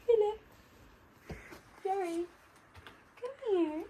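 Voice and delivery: high, soft sing-song coaxing; coaxing animals. Real, not acted. Woman coaxing sheep in the field, high and soft. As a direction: lure the sheep gently over.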